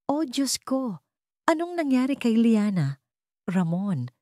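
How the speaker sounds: a touch of high, theatrical alarm from a pretender; shrill, theatrical concern. A narrator's reading. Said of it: "Ang kanyang tinig ay matinis, halos theatrical". (bahagyang matinis at madramang kunwaring pag-aalala)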